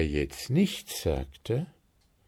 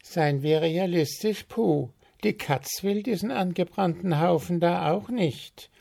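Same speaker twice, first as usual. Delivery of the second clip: high-pitched matter-of-fact bird; frank retort; 9 semitones higher than usual. A narrator's reading. Willi setting Puh straight about burnt cake, frank. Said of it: "erwiderte Willi".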